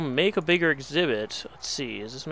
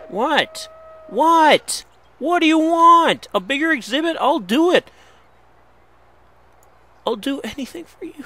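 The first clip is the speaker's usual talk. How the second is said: much higher and louder, pleading at the wolves; exasperated yelling; 11 semitones higher, 7 dB louder than usual. Real, not acted. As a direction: shout it out in frustration at the animals. Yelling at unhappy zoo wolves.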